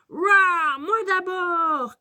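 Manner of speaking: high, loud, drawn-out shout; cri de Nino Dino. A narrator's reading. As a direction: yell to be served first. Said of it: "crie Nino Dino". (cri aigu de petit dinosaure impatient)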